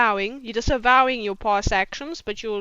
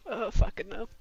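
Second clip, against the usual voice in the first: ordinary talk, nothing marked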